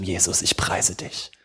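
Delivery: soft, unvoiced whisper of worship; whispered praise. Preacher voicing quiet worship to Jesus, whispered. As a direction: let the praise fall to a whisper.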